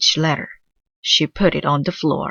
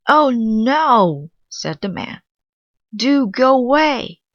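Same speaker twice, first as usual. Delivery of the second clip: exasperated; 8 semitones higher, 4 dB louder, slower than usual. Acted. Man upset at ruined papers.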